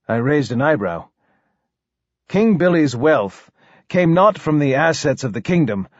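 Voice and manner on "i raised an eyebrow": usual speaking voice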